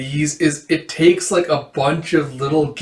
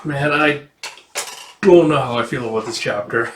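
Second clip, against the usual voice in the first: ordinary talk, nothing marked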